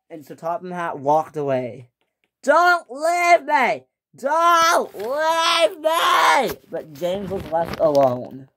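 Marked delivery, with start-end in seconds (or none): 2.4-6.6 slow, high wailing plea; desperate pleading. Acted. James begging Sir Topham Hatt not to leave. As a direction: drag out the begging, high and loud.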